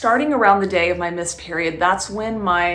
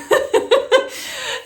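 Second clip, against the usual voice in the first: high-pitched burst of giggling; high giggle; 3 dB louder than usual. Real, not acted. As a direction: let a squeaky laugh escape. Laughing at husband's plea.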